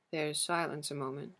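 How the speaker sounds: ordinary delivery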